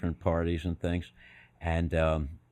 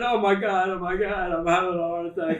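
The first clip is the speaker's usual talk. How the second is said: higher, overwhelmed relief; breathless relief; 9 semitones higher than usual. Retold or imitated. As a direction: gush it out, weak with relief. Retold relief, heart attack.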